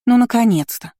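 loud, high cry of impatient relief; exclaiming. A narrator's reading. Aristocrat on the threshold as the door opens, exclaiming. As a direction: burst out at last. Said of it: "воскликнула показавшаяся на пороге Шарлотта".

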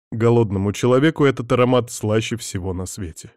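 ordinary delivery, nothing marked

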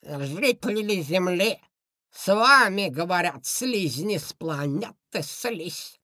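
slow, booming, squelching alien voice; squelching slimy alien. Acted. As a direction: boom it out like a slime creature. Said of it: "раздался громогласный чавкающий голос".

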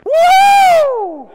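long, very loud high whoop; exultant whoop. Real, not acted. Preacher celebrates Jesus winning, exultant whoop. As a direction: let out a huge sustained "woo". Whoop after triumphant climax.

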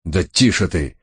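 ordinary delivery, nothing marked

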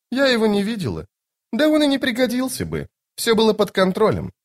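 high, airy lady's voice; Lady Envy, dismissive. Acted. Lady Envy dismissing Toc's question, high and assured. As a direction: brush it off in a high register.

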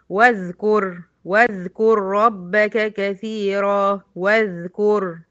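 slow recitation, واذكر joined wa-dhkur; Quran recitation. Shown as a demonstration. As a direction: chant it slowly, joining the waw. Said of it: "في الوصل دخلت من الواو على الذال". (تلاوة بطيئة موصولة)

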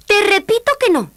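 high, loud refusal; princess shout. A narrator's reading. (princesa que grita)

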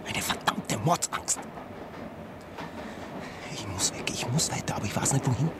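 hushed, breathy dread; fearful whisper. Acted. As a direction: whisper it in terror. Fear, unvoiced whisper.

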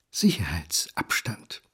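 low, slow whispered word. Acted. Son on Paula keeping away, slow whisper. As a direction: hiss it out dryly.

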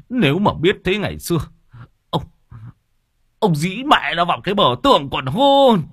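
put-on old father cursing his drunk son. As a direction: rail at the son and the wife.

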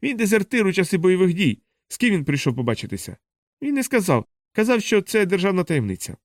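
high-pitched storybook shouting; mild shouting. A narrator's reading. Men shouting down the corridor.

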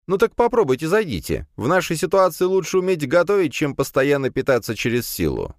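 high, bright-eyed student voice pleading with the rector; a hopeful young man asking a favour; eager young student. A narrator's reading. Student hopefully asks the rector about a club, in a high young voice.

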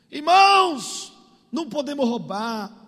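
loud, high drawn-out call, then settling; preaching pastor. Retold or imitated. Pastor warns the church, imitated preaching call. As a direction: hail the congregation like a pulpit preacher. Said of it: "o pastor tava pregando".

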